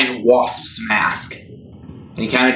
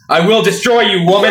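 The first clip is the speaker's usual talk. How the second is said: angry threat; 7 semitones higher, 4 dB louder than usual. Acted. Character threatens.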